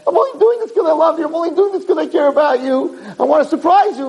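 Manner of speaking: very high, strained-up imitation of a pleading wife; impassioned mock protest. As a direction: protest your love at the top of your range. Wife's unspoken protest, acted over screaming.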